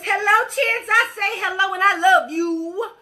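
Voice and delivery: high, loud, gushing hello; excited greeting. Real, not acted. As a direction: greet a viewer with a burst of joy. Greeting viewer Chance by name.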